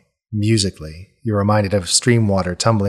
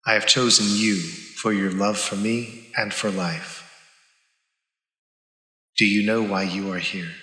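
a softer, measured divine calm; cool goddess voice; 5 dB quieter than usual. Acted. Goddess passing judgement on her paladin, cool and soft. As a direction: speak as a serene, distant goddess. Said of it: "Visham's cool voice".

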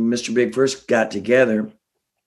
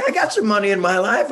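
laughing; 8 semitones higher, faster than usual. Real, not acted. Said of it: "I know, I know".

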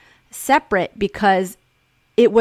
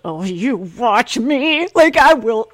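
slow, then louder challenge; defiant; 4 semitones higher, 5 dB louder, slower than usual. Retold or imitated. Defying her mom, slow and defiant. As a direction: dare mom to doubt you. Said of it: "And I was like".

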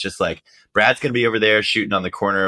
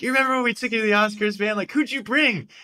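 much higher, quick put-on voice; imitating George Clooney; faster than usual. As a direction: rush the question up high. Clooney quoted asking Radio Man.

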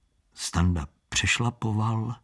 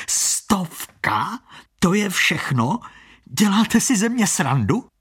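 mock outrage; 9 semitones higher than usual. Blind con man scoffing at a hundred-crown bet, mock outrage. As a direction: play offended to shame him.

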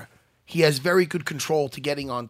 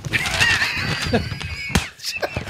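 very high breathless shrieking laugh. Real, not acted. Laughing fit at the producer.

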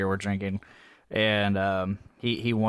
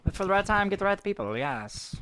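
fancy gentleman voice; 8 semitones higher, 4 dB quieter than usual. Acted. Fancy voice bit.

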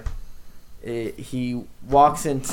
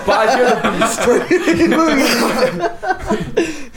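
high, slow words through laughter; can't get it out for laughing; 11 semitones higher, much slower than usual. Real, not acted. Cracking up mid-bit.

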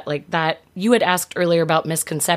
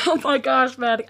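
shocked gasp; 7 semitones higher than usual. Real, not acted. Reacting to stroller story.

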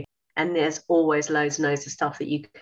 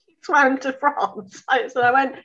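ordinary talk

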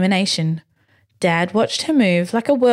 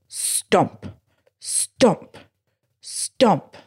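heavy stomps, each word spaced out; pounding steps; much slower than usual. A narrator's reading. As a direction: land each stomp like a giant footstep. Big pounding steps, read aloud.

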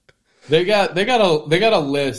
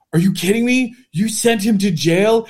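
mock panic; 6 semitones higher than usual. Imagined staff yelling over a jailed player, mock panic. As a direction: play the panicked comms room, higher.

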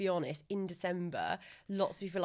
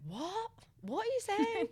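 1 puzzled American. Retold or imitated. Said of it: "they're like".

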